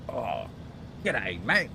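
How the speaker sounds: hammed-up Aussie accent, pitched high; bad fake Australian accent. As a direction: exaggerate a bad Australian accent, rising at the end. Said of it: "bad fake Australian accents".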